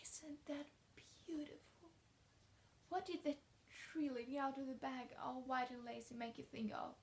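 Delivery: dreamy hush over something lovely; hushed wonder. Acted. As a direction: marvel softly like an awed child. Child marvels at blossoming branch.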